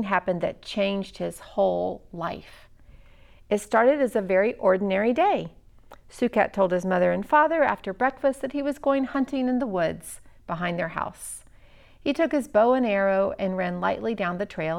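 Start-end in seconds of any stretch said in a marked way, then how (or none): none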